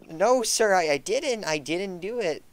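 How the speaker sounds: high pleading denial; scared high voice. Acted. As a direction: plead your innocence, voice up high. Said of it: "And Stevie goes".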